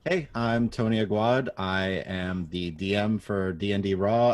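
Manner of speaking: ordinary talk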